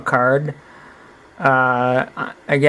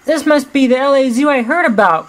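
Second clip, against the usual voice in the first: much higher lion cub voice; Copa's thoughts; 11 semitones higher than usual. Acted. Copa thinking to himself in a high voice. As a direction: wonder it to yourself as Copa. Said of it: "Copa thought".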